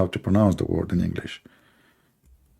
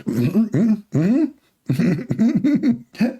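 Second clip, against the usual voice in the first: high, strangled mm-hmms after a burning drink; strained mm-hmm; 10 semitones higher, much slower than usual. Retold or imitated. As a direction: squeeze out a pitched-up mm-hmm, too scorched to speak. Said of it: "I did not talk after that for five minutes".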